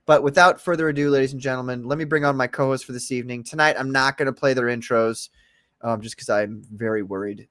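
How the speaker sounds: ordinary talk, nothing marked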